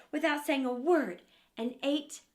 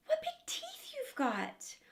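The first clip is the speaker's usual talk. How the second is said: high, soft, scared whisper; girl whispering her fear to the wolf; frightened whisper; 4 dB quieter, slower than usual. Acted. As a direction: barely breathe the words.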